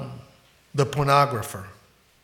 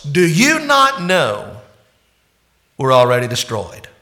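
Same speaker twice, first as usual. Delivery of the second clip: insulting rebuke; 5 dB louder than usual. Acted. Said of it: "the mightiest of insults".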